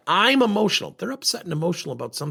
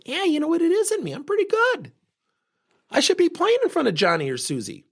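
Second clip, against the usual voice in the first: put-on much higher, cocky child's voice; entitled kid. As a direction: play the kid who thinks he's owed a spot.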